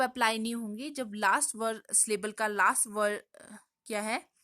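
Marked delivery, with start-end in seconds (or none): none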